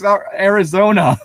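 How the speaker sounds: normal talking voice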